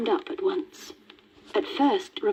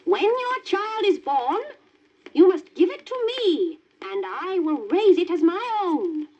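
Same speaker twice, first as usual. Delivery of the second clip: high, commanding witch voice; demanding witch; 10 semitones higher than usual. Acted. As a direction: claim the child like a greedy witch. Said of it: "demanded the witch".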